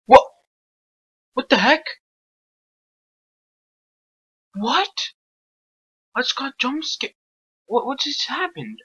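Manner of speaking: high startled yelps after a jump scare; shocked and confused, pitched high. Real, not acted. Jump scare in horror game.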